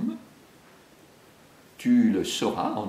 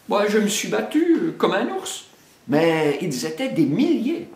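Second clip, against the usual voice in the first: high, loud bragging excuse; boastful bear; 10 semitones higher, 4 dB louder than usual. Acted. Baloo exaggerates the fight to Bagheera, blustering. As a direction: puff up your story.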